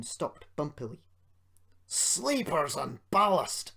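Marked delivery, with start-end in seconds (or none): 1.9-3.7 slow, louder startled cry; exclamation. A narrator's reading. Duncan crying out as he derails, startled. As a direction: blurt it out in shock. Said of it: "he exclaimed".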